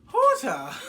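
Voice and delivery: giddy laughing hoot; laughing whoop. Real, not acted. Host laughing after mom's exit.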